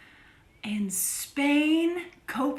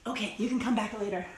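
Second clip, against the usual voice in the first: ordinary talk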